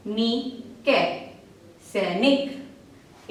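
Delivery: slow sounding out, each syllable apart, then the whole word. Shown as a demonstration. Teacher sounds out words syllable by syllable for children. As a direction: break every word into syllables, then blend it. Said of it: "और अब ये शब्द पढ़ते हैं".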